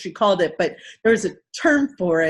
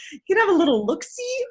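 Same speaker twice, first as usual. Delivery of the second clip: high, quick, sing-song coax; lightly voiced sweet salesman tempting the table; coaxing waiter; 10 semitones higher, faster than usual. Waiter coaxing full diners to see dessert, high lilting. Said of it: "that's how she said it".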